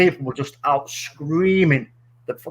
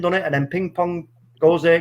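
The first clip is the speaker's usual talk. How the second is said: ordinary delivery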